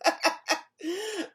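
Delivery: high snickering chuckle; sly fox laugh. A narrator's reading. Fox watches the freezing bear wait, high snicker.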